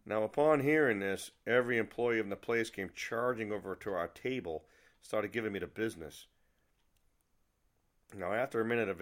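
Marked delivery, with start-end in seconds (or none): none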